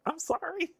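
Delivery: high mocking sorry, hammed up; mock apology. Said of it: "It's like".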